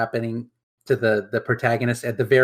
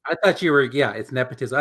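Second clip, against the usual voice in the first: normal talking voice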